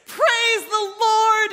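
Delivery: high, drawn-out shout of praise; loud, bold praise. Acted. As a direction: proclaim it at full force, very slowly. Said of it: "it says, loudly".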